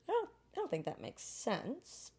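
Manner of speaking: high, soft, slow hesitation; drawn-out, small-voiced scepticism; doubtful. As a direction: voice a tentative inner doubt. Voices own doubt in anecdote.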